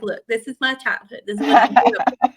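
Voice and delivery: put-on fast, laughing Southern accent defence. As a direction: defend her roots in a playful Southern accent. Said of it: "all of my Southern accent came out".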